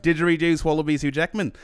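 put-on high, loud Australian accent. Reciting a mantra to get back into an Australian accent. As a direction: chant it in broad Australian. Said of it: "how they always got back into it".

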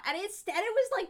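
normal talking voice

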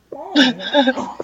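loud, high, drawn-out "wow" like a movie star; a light, loud Owen Wilson "wow". Retold or imitated. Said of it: "You said it like Owen Wilson".

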